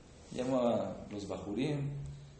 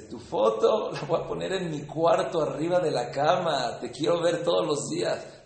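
louder, higher quoted rabbi, lightly voiced; playful rabbi reply; 6 semitones higher, 9 dB louder than usual. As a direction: hand the donor a sly answer. Rabbi answers the donor cleverly.

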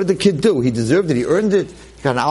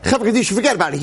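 high, giddy dismissal; relieved father; 10 semitones higher, much faster than usual. Retold or imitated. Father hanging up on the burial society, giddy and high. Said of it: "the guy's like".

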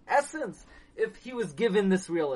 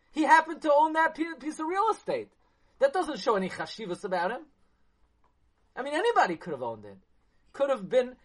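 incredulous challenge; 6 semitones higher, 4 dB louder than usual. Acted. Challenging his own question.

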